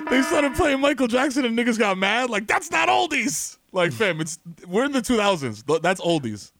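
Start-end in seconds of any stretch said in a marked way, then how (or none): 2.5-3.6 high, slow indignant protest; mock outrage. Listeners object to newer music, imitated indignation. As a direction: protest it pitched up, stretching the words. Voicing angry radio listeners.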